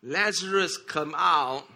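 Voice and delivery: mildly projected calling-out; retold loud cry. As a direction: echo a loud cry with restraint. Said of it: "when he cried out loudly".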